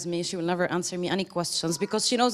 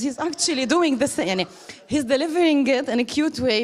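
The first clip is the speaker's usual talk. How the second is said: higher, louder, delighted rush; excited realisation; 7 semitones higher, 5 dB louder than usual. Retold or imitated. About Robert's funny method, excited gushing. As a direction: gush about him like a discovery.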